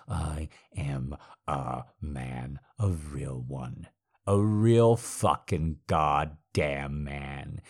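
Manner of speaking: slow, weighted declaration; slow emphasis. Acted. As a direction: drag each word out.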